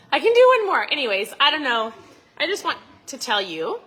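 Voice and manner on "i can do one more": "I can do one more" shouted high and loud; self-pep shout. Retold or imitated. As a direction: yell it to push yourself through. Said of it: "And I'd be like".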